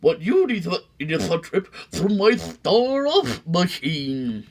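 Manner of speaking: wink through the pitch; sly salesman. Acted. McBean luring the sneetches back, high sly salesman voice.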